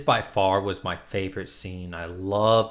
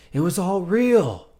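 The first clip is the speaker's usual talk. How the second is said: higher, louder, slow realization; stunned outcry; 9 semitones higher, 7 dB louder, slower than usual. Retold or imitated. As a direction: shout it out slowly as the truth dawns. Mark realizing the truth.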